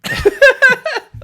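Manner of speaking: high shrieking laugh; loud burst of laughter. Real, not acted. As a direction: crack up loudly.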